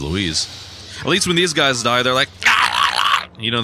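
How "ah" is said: low, loud hammed-up dying grunt; low mock death cry. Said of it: "they make, like, stupid noises".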